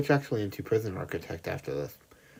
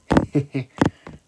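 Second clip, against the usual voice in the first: short laugh; 6 semitones higher, 9 dB louder than usual. Real, not acted. Laughs at own joke.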